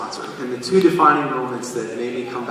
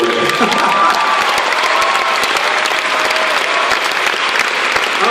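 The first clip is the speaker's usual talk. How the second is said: emotional laugh; 5 semitones higher, 5 dB louder than usual. Real, not acted. Emotional laugh after story.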